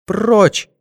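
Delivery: high-pitched cry of rejection; woman's high, recoiling shriek; sharp outcry. A narrator's reading. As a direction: cry out to drive someone away. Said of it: "вскрикнула она".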